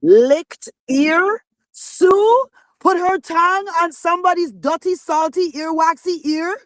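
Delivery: high, incredulous, building to a slow gross-out; outraged disbelief. Acted. Denying she licked the man's ear, high disgusted disbelief. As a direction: act disgusted that anyone believes it.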